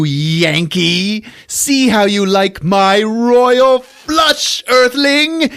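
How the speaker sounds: high, loud, hammy evil-emperor gloat; cackling villain. Acted. Villain Wang gloating at the trapped heroes, hammy and loud. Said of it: "Wang shows up on the view screen".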